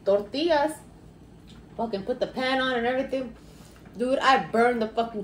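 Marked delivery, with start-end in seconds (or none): none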